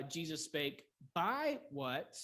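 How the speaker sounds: slow, BY stretched and punched; stress on BY. Shown as a demonstration. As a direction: hit the preposition by before what. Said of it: "most likely a preposition pattern".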